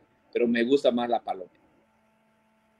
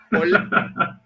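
laughing; 6 semitones higher than usual. Real, not acted. Laughing reaction.

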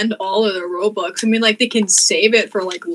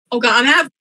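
ordinary talk, nothing marked

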